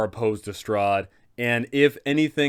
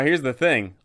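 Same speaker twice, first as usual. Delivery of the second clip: ordinary talk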